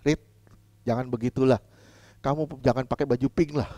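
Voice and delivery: soft, kindly correction; gentle rebuke. Shown as a demonstration. Pastor gently correcting Rita about her pink clothes, soft and kind. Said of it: "Saya menegur Rita misalnya".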